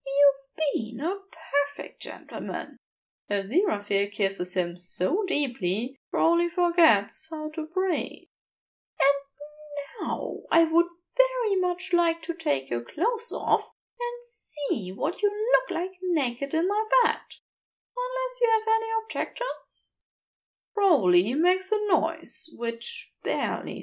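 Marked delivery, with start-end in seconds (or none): 0.1-2.8 lightly higher, gentle angel voice; Aziraphale voice. A narrator's reading. Aziraphale tender. 9.0-20.0 lightly higher, gentle angel voice; Aziraphale voice. A narrator's reading. Aziraphale flirting.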